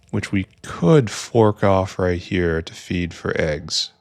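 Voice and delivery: ordinary delivery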